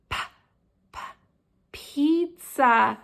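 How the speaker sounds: isolated puffed "Pa, pa", then "pizza"; sounded-out P. Shown as a demonstration. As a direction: pop the first sound apart before saying "pizza". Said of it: "what letter makes that sound?".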